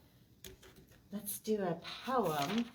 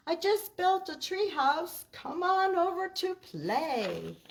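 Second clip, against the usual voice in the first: higher, louder cheerful cat voice; calling friends over, higher and louder; Pete calling friends; 10 semitones higher, 7 dB louder than usual. Acted. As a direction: call out to friends like an excited cat. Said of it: "He calls his friends".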